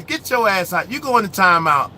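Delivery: usual speaking voice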